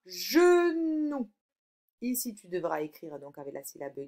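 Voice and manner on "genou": slow, stretched word; sounded out. Shown as a demonstration. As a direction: draw out both syllables for the pupil. Teacher sounding out the answer word. (mot étiré, syllabe par syllabe)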